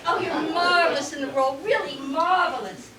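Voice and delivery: usual speaking voice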